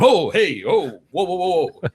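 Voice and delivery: a hint of startled retreat; lightly played nervous whoa; mock backing off. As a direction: back off from the gun. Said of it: "all of us would be like".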